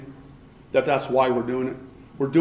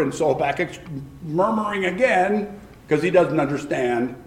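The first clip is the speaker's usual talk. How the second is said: ordinary talk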